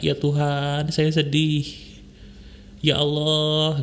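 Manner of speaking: mild mock weeping plea, words drawn out; subdued imitation of a tearful prayer; demonstrated tearful prayer. Shown as a demonstration. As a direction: show how to call on God while crying, understated. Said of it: "Ketika menangis, sebut nama Tuhan".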